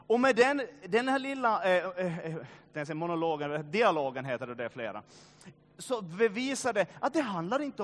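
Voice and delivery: loud, fervent pulpit preaching. Acted. Pastor rousing his congregation, fervent preaching. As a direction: preach it out hard to the whole church.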